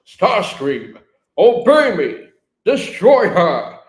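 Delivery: loud, commanding villain shout; imperious shout, rising high; Megatron. Acted. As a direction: bark orders at a disobedient underling, drawing out the end. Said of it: "And Megatron goes".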